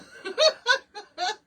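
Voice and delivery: high burst of laughter; high amused laugh; bright laugh. Real, not acted. Laughs at own accent claim.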